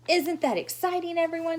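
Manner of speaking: put-on high, bright gushing little girl; gushing showy girl. Lucille gushing to the children, high and showy. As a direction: gush to the whole class.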